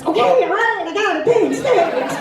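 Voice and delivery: a God who has heard plenty, half laughing; God overwhelmed. Pretend. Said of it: "he says".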